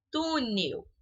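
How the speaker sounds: Túnel with TÚ held long; stress on first syllable. Shown as a demonstration. Teacher giving a paroxytone example, stress on TÚ in Túnel. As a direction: lean on the Tú- of Túnel. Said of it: "Tu é mais forte".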